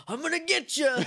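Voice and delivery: hammed-up battle cry. Tommy taunting the kids before his dodgeball throw, laughing mock threat. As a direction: yell it like a cartoon kung fu kid, laughing. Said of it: "He goes".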